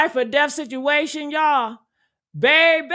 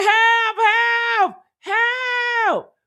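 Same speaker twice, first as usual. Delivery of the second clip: high, strained cries for help; panicked yelling for help; 10 semitones higher, much slower than usual. Pretend. Picturing a sinking boat, screaming help in panic. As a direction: scream for help like you're drowning. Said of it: "wouldn't you be like".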